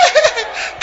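high burst of laughter. Real, not acted. Preacher delighting in the congregation, high laugh. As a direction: laugh freely with the crowd.

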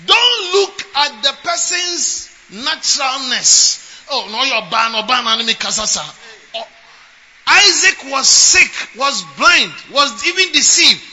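loud, building sermon climax; rising fiery preaching; preaching at full force. Acted. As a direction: drive it home to the congregation.

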